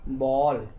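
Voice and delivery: बॉल with the vowel halfway between aa and o; sound between aa and o. Shown as a demonstration. Teacher showing pupils the vowel between aa and o. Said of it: "इसको ये दोनों के बीच में बोलते हैं".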